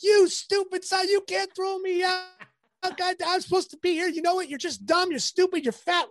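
retold meltdown, yelled way up high at the third base umpire; furious rant. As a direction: let it rip like a raging hothead, shrieking insults. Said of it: "went all Billy on him".

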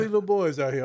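ordinary delivery

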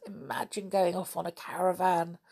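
plain reading voice

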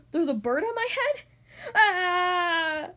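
gasping, panicked elephant; alarmed gasp. Acted. Elephant panics over bird.

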